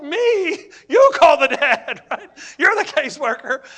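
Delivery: high, loud indignant "Me?" with laughter; laughing protest. Retold or imitated. Houseparent pushes the call back on the caseworker, laughing. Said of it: "I'm like".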